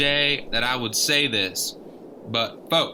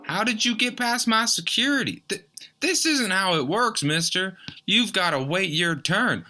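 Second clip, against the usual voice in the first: higher, indignant host scolding; flustered objection; 7 semitones higher than usual. Acted. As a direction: protest the intruder sharply.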